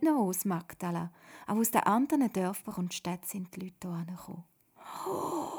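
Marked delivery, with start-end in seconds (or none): 5.1-5.5 high, airy gasp of wonder; amazed "oh". A narrator's reading. Miriam seeing the crowd, amazed high "oh". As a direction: let out an astonished "oh". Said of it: "staunet Miriam".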